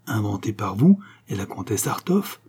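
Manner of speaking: ordinary delivery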